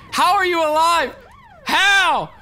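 shouted disbelief; disbelieving shout. Real, not acted. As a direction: yell at the screen in disbelief. Disbelief yelled at revived enemy.

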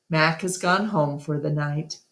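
ordinary delivery, nothing marked

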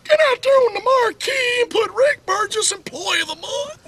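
high squeaky boss voice; boss's high-pitched voice. Retold or imitated. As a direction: order it in a high wheeze. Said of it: "Like that".

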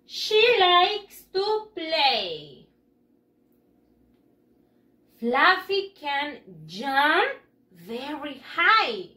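very slow, words drawn out; slow model reading. Shown as a demonstration. Teacher reading slowly so pupils can repeat. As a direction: spell out each word by voice, slowly. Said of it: "repitan después de mí".

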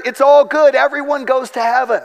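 mock the researchers' easy optimism; mock confident declaration. Researchers declaring near-death stories all good: mock cheerful. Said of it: "the researchers declared".

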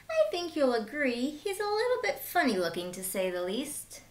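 put-on bright, lively read-aloud for kids; lively children's storyteller; storybook narration. Reading picture book to children.

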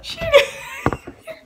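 high burst of giggling after the shout; embarrassed high laugh. Real, not acted.

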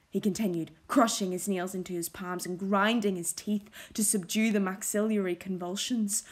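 plain reading voice

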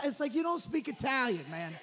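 ordinary talk, nothing marked

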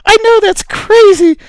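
normal talking voice

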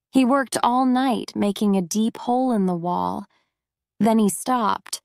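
ordinary delivery

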